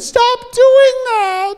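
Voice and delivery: very high, slow whine; exasperated kid. Acted. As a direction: drag out the complaint. Boy exasperated again.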